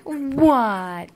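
normal talking voice

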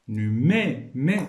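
"Nu-" split off, then "mais" as "mé"; teacher isolating "mais" as a clear syllable "mé"; sounded-out syllable. Shown as a demonstration. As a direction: break the word after "Nu-" and repeat "mais" as "mé". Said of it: "غير من خلال الصوت".

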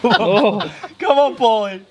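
excited cheering. Real, not acted. Cheering on the eater.

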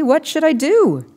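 high, slow plea; desperate. A narrator's reading. Maddie asking the men for help, desperate. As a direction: beg for help. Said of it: "I said desperately".

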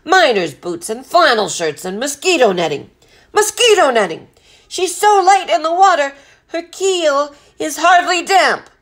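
outraged captain climbing high and loud; blustering, incredulous rant; bellowing. A narrator's reading. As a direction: bellow the list with mounting indignation. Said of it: "Captain Swain bellowed".

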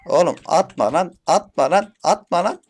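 shouting at the bot throwing grenades, angry; angry yelling. Real, not acted. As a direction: yell at him to stop.

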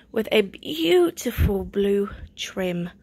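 slow, breathy whisper of admiration. Real, not acted. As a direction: whisper it like a secret treasure. Hushed admiring of shirt trim.

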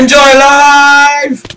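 loud, high, drawn-out cheer; mock cheer. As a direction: stretch it out like a sarcastic celebration. Echoing friend's line as mock cheer.

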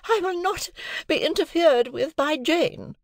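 put-on lofty wife scorning the girl; majestic Mrs Pocket. A narrator's reading. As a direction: look down your nose and drag it out. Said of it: "with a majestic glance".

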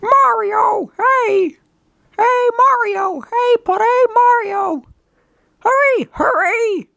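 very high, drawn-out cartoon calling; urgent cartoon caller. Game character hurrying Mario along, high and urgent. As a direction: call out in a squeaky, insistent voice.

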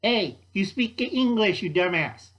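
put-on loud, brash New York accent; high, pushy New York cockroach. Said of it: "in a New York accent".

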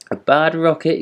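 high, outraged disbelief; indignant shock. A narrator's reading. Rocket thrown into the ditch, indignant high echo. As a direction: repeat the insult in disbelief. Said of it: "he said as he whirled through the air".

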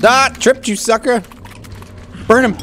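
loud high yell; triumphant yell. Real, not acted. Player springing a trap, triumphant yell. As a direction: shout in triumph.

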